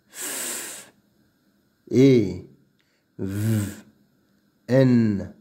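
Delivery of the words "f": "f" 를 목소리 없이 아주 작게 바람만; f sound alone. Shown as a demonstration. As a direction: breathe "f" out softly, no voice.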